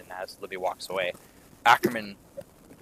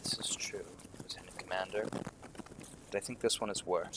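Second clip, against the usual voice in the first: hushed confiding; 3 semitones lower, 12 dB quieter than usual. Acted. Ackerman confides secret distrust.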